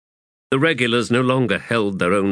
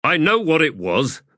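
higher, sharp retort; snapped; 9 semitones higher than usual. Acted. Pors snapping at Faradan Sort, a sharp higher retort. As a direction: cut her off curtly. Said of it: "Pors snapped".

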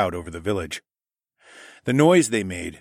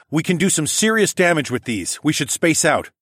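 urgent raised voice; 7 semitones higher, 4 dB louder than usual. Acted. Urgent plan under attack.

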